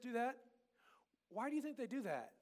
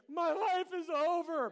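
high, loud, slow mock lament; overdramatic despair; 7 semitones higher, 8 dB louder, slower than usual. Shown as a demonstration. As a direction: ham it up like a sulking kid. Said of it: "Is that when you say".

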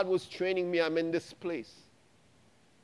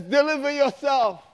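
higher, louder ringing command; proclaimed command; 8 semitones higher, 7 dB louder than usual. Retold or imitated. God repeating the command, now ringing out loud. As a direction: declare it like God thundering. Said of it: "he says".

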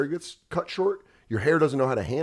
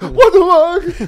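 much higher and louder, slow outraged squeal; freaked-out dog voice; 11 dB louder, much slower than usual. Retold or imitated. Dog refusing the car, high slow outraged squeal.

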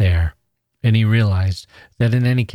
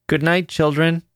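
higher, odd and strained goodnight; strange voice; 8 semitones higher than usual. A narrator's reading. Charlie bids the children goodnight in a strange voice. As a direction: say goodnight as if something is wrong. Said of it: "said in a strange voice".

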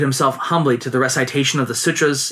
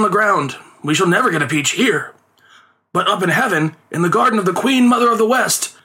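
higher, excited inspiration; lightly voiced eager father; father cries out; 8 semitones higher than usual. A narrator's reading. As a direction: announce the bright idea to his son. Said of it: "the father cried out".

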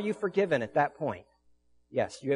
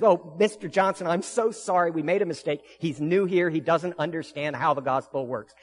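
higher and louder, rushed flustered pleading; mock-frantic bank CEO; frantic apology; 4 semitones higher, 5 dB louder than usual. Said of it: "she's frantic and she says".

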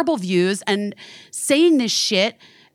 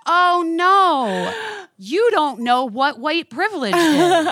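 mock pity; 7 semitones higher, slower than usual. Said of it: "then I go".

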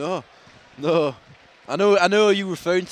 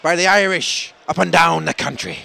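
slow, louder mock-Irish announcer; 3 semitones higher, 7 dB louder than usual. Host hamming up a podcast plug, mock Irish accent. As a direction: put on a broad Irish radio plug. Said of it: "with Terry Wogan".

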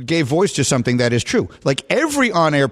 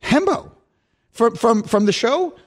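pleading reminder; 9 semitones higher than usual. Retold or imitated. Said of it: "I said".

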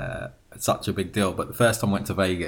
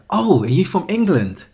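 higher, louder mock amazement; impressed Americans; 7 semitones higher, 5 dB louder than usual. Stranger in Vegas hearing a British accent, imitated amazement. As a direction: imitate someone struck by the accent. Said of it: "people go".

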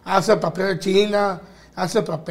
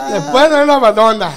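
laughing; 4 semitones higher, 6 dB louder than usual. Real, not acted. Boy jokes after giggling.

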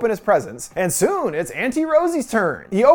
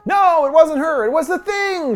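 hammed-up distressed denial; acted desperate denial; 8 semitones higher than usual. A narrator's reading. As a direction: voice her frantic explanation, pitched right up.

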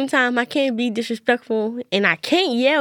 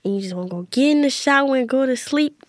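normal talking voice

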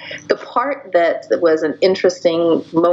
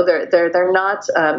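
usual speaking voice

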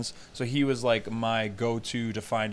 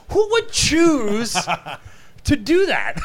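laughing disbelief; 14 semitones higher, 7 dB louder, slower than usual. Retold or imitated. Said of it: "I'm like".

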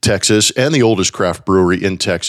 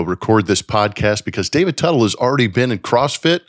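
usual speaking voice

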